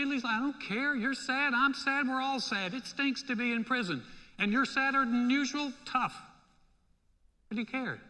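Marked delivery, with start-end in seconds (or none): 0.4-6.1 high, mock-callous brush-off; exasperated, pitched-up indifference; dismissive retort. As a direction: shut down the complaint with acted impatience. Acts out uncaring prisoner's retort.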